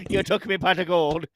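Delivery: put-on Irish leprechaun accent, laughing; a hammy Irish leprechaun complaining. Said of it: "He's a leprechaun".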